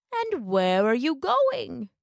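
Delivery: slow wolf voice, swooping on the stressed word; sly wolf. A narrator's reading. Wolf asking Little Red her name, slow and drawn out. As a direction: stretch the question out slyly.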